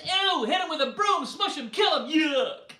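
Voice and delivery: high, loud shrieks of disgust; shrill squeals of ew and kill; disgusted shouting. Acted. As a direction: yell like a crowd grabbing brooms. People chasing off the snakes.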